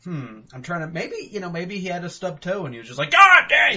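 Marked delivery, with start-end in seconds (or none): 3.1-3.8 high, loud yelp of pain; mock stubbed-toe shout; pained yell. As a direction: yell like you just stubbed your toe. Guy stubbing his toe.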